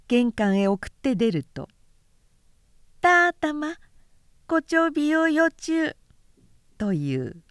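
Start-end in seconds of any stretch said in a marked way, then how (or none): 3.0-6.0 put-on high lisping toddler voice; high baby-talk farewell; toddler greeting. A narrator's reading. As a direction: babble the greeting like a tiny boy seeing off his father. Toddler greets father at door.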